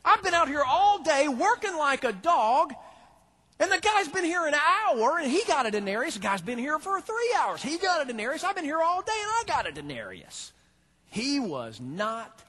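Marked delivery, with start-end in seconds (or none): 0.0-10.5 loud, high indignant rant; he's furious he got cheated; complaining. Retold or imitated. Worker ranting at the landowner, raised indignant complaint. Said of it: "he starts complaining to him".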